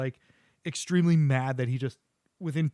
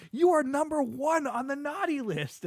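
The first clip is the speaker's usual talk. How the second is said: high, slow mock outrage; scolding Santa; 12 semitones higher than usual. Santa condemning the devil, acted indignant scolding. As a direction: pronounce judgment in a raised, drawn-out voice.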